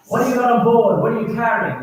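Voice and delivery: commanding radio demand. Retold or imitated. Navy repeating its radio demand.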